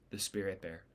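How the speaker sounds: ordinary delivery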